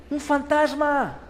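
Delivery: high, slow mock terror; hammed-up fright. Disciples shrieking at a ghost, hammed-up fright. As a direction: overdo the scared squeal.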